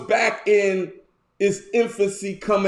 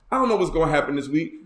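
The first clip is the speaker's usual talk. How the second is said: ordinary talk, nothing marked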